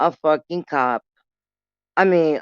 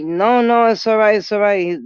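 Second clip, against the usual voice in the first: higher, louder nervous no, no; panicked reassurance; 6 semitones higher, 6 dB louder than usual. Retold or imitated. To the cops, panicked higher louder brush-off.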